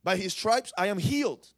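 loud, high preacher's cry; preaching at full force. Acted. Preacher booming the verse to the church. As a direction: proclaim it to the congregation.

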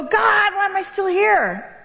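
a tearful shout to heaven; crying out to God. Retold or imitated. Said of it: "I go".

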